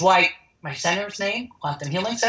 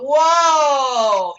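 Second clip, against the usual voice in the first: high, loud burst of wonder; amazed whoa; 7 semitones higher, 6 dB louder than usual. Retold or imitated. Telling listeners about the vanishing tumor, a loud amazed whoa. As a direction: blurt it out like you can't believe it.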